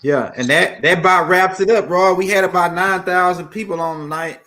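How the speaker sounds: loud, upbeat show wrap-up; host sign-off. Real, not acted. Host thanking the audience at the end of tonight's show, loudly. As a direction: close the show like a live host.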